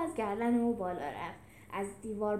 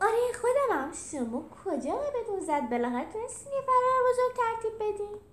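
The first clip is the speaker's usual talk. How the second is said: high, loud, delighted greeting; thrilled, high-pitched and boisterous; happily; 11 semitones higher, 6 dB louder than usual. Acted. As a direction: burst out with glee at the reunion. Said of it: "او با خوشحالی گفت".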